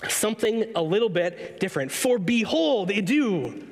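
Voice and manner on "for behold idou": "behold" and "idou" pitched up and drawn out; teacher-like swooping stress on "behold" and "idou"; emphatic behold. Retold or imitated. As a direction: give "behold" and "idou" slow, weighty emphasis. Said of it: "'Cause it adds that emphasis".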